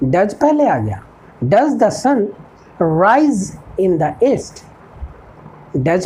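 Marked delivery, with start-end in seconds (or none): 1.5-4.6 each word spaced out for learners; a teacher dictating an English model sentence, slow and clear; slow dictation. Shown as a demonstration. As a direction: spell it out slowly like a teacher at the board. Teacher slowly dictates model question.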